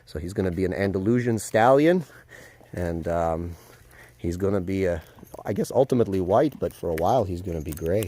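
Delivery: usual speaking voice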